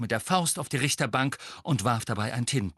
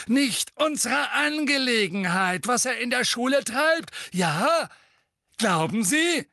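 mocking; 5 dB louder than usual. A narrator's reading. Fudge mocking.

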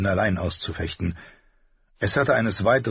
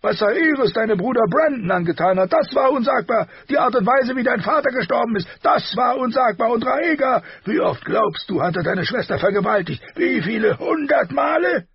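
raging king, high and loud; furious shouting; 6 dB louder than usual. A narrator's reading. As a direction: bellow out years of hatred. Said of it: "Seine Stimme war so laut geworden".

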